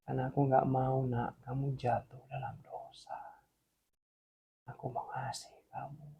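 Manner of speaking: soft, fading into a whisper; tender voice of God. Acted. God reassuring the child who nearly fell, tenderly hushed. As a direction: hush it down to a loving whisper. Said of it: "Tuhan ngomong apa".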